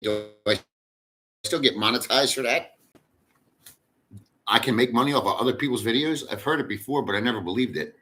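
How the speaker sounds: normal talking voice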